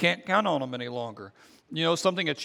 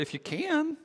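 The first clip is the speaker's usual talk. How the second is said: high, incredulous echo of his words; offended echo; 11 semitones higher, 4 dB quieter than usual. Retold or imitated. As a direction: throw his doubt back at him, high and offended. Jesus offended, mocking the father.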